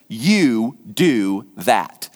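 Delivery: "do" stressed, said low and very slowly; commanding low stress on "do"; emphatic do. Shown as a demonstration. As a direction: drag out "you do that" with weight on "do". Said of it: "the do is emphatic".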